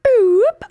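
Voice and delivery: high, loud rising "boop"; sliding-up alarm noise for a lie; lie-o-meter boop. Pretend. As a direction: imitate a buzzer swooping upward. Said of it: "that's my lie-o-meter".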